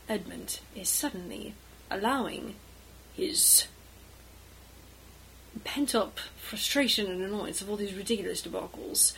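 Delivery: usual speaking voice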